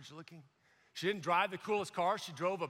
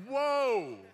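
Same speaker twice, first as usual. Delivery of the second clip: louder, higher, astonished whoa; amazed question; 6 semitones higher, 5 dB louder, slower than usual. Retold or imitated. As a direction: marvel at her intensity. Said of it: "You're like".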